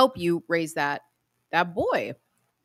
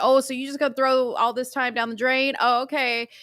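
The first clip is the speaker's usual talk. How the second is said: high, sassy mock of the girlfriend; mocking attitude; 7 semitones higher than usual. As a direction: mimic her snippy tone. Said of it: "you hear the attitude".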